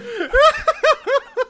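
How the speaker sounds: loud laughter way up high; high laughing shriek. Real, not acted. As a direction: crack up completely. Recalling the funny moment.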